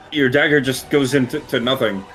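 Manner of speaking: normal talking voice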